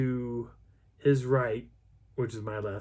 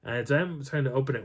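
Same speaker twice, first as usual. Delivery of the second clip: ordinary delivery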